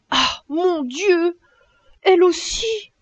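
high, slow astonished outcry; dismayed farmer hearing his goat wants to leave; stunned cry. A narrator's reading. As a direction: cry out in disbelief. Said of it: "cria monsieur Seguin stupéfait".

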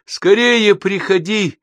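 high, loud, drawn-out call; calling after. A narrator's reading. Mother calls after her departing son, calling loudly. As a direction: call out across the distance. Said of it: "кричит мать уже вслед".

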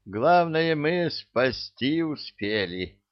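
high, slow proud boast. A narrator's reading. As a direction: announce it with pride. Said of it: "гордо сказал трус".

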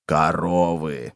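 low, very slow mild cry; understated low cockroach exclamation; cockroach calling out. A narrator's reading. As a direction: call it out low and drawn out. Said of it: "закричал таракан".

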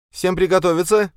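shouted high and loud warning; frantic retreat order. Acted. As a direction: yell for everyone to brace. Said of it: "крикнула назад Сёкол".

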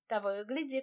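ordinary delivery

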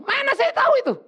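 high, laughing outburst; laughing retort. Retold or imitated. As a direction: toss it back, laughing in disbelief. (balasan sambil tertawa, nada sangat tinggi)

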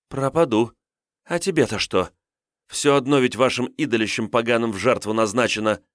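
put-on high, sulky defiant girl; defiant young girl. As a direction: snap back like a stubborn captive. Girl captive character voice.

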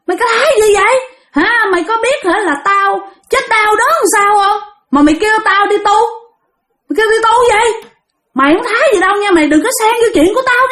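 high-pitched indignant retort; fierce defiance. Retold or imitated. Angry spirit refusing the master's order to become a nun, fierce. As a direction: act out an aggrieved spirit lashing back. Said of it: "Lúc đó bả làm dữ lắm".

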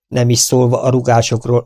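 ordinary delivery

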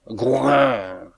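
long falling animal grunt; drawn-out beastly snort; bear grunt. A narrator's reading. Narrator voices the bear's grunt.

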